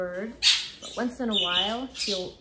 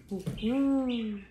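lightly hooted owl sound; 3 semitones higher, 4 dB louder than usual. Acted. Owner showing the parrot an owl's call, light hoot. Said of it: "The owl goes".

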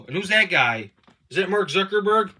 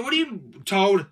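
ordinary talk, nothing marked

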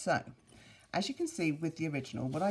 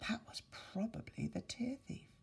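soft, higher, hushed voice; hushed mother; 8 semitones higher, 8 dB quieter than usual. Acted. The mother tells her son it was the Tear Thief, hushed voice. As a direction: share it as a spooky secret. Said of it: "said the boy's mother".

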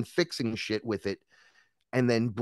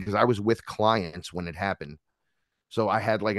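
ordinary talk

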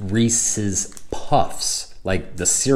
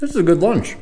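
mouth full; 11 semitones higher, faster than usual. Real, not acted. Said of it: "trying not to talk with my mouth full".

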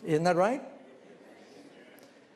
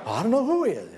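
louder, higher mock protest; upset guest; 8 semitones higher, 6 dB louder, faster than usual. Insulted guest to the host, acted indignation. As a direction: act out an indignant, raised-voice denial.

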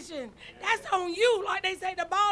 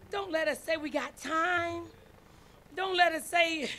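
usual speaking voice

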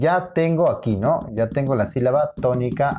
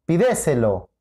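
"pídeselo" stressed as pi-DE-se-lo; stress on second syllable. Shown as a demonstration. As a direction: hit the DE of "pídeselo". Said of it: "¿Cuál es?". («pídeselo» con acento en DE: pi-DÉ-se-lo)

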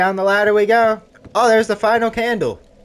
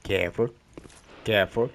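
low and soft, rising at the end; hushed, low caution; cautious murmur; 9 semitones lower, 9 dB quieter than usual. Real, not acted. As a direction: say it softly, treading carefully. Creeping cautiously in the game.

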